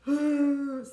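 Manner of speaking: loud, high mock sobbing wail; sustained high crying "hoo" as a demo; demonstrated sob. Shown as a demonstration. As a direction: let out a big showy sob. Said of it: "So you're just like".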